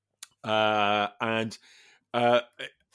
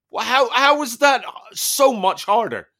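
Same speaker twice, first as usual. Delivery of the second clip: much higher, louder incredulous whine; baffled protest; 12 semitones higher, 6 dB louder than usual. Retold or imitated. Asking the trainer why the ropes got harder, high incredulous whine. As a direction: squeak it out in disbelief.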